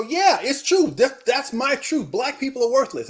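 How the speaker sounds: high-pitched, mock-adamant protest; insistent inner voice. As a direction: play the stubborn mind insisting it's right. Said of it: "your mind initially is gonna go".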